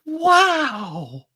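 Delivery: high, chirpy sing-song wow; chirrupy sing-song exclamation. Acted. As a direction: chirp it up like an excited little voice. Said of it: "exclaims a chirrupy sing-song voice".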